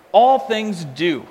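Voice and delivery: All things do, slowly, each word weighed; deliberate wooden translation; slow literal reading. Shown as a demonstration. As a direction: read it out word by word. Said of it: "A very wooden, literal translation".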